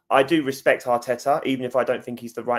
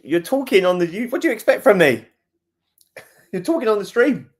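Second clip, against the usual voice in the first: high, loud, laughing outburst; exasperated squeal breaking into laughter; incredulous protest; 9 semitones higher, 7 dB louder than usual. Real, not acted. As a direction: protest in disbelief, pitch shooting right up. Laughing, protesting at someone on stream.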